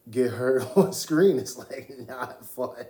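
talking through real laughter; amused, laughter breaking into the words; helpless laughing. Real, not acted. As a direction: try to say it seriously but keep cracking up. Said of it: "I don't know why I'm laughing".

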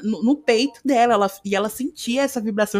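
ordinary delivery

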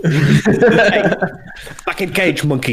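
high, laughing mock command; laughing taunt. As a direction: scold him like a zoo keeper, laughing. Teasing muted friend.

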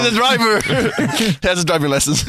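laughing through a joke; giggling at his own story. Real, not acted. Laughing about the driver.

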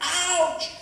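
high, loud comic ouch; mock yelp. Dad clowning to make his daughter giggle, mock yelp. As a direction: yelp as if sat on a tack. Acts out sitting on tack.

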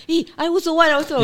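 eager little brother. Retold or imitated. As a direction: play the eager brother begging for a chair. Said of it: "Then my brother said".

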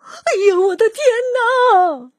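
put-on old woman shrieking at the bear; startled shriek. A narrator's reading. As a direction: shriek as if the bear grabbed at you. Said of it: "瘦小的老妇人喊起来".

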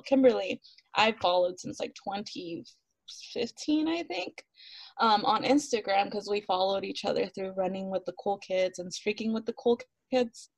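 normal talking voice

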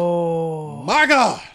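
loud, high disbelieving cry; shocked outburst. Real, not acted. Son hears his dad is still in Atlanta, shocked cry. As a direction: blurt it out in sheer disbelief.

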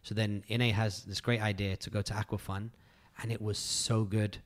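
ordinary talk

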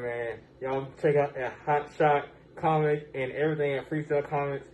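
normal talking voice

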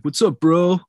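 mock American frat boy greeting. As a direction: imitate a Brit faking an American bro. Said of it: "a stereotypical, he throws in bro a lot".

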